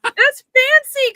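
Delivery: high, loud, drawn-out insistence; emphatic fancy. Real, not acted. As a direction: stretch it out high and loud, delighted. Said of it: "insisting sausages fancy".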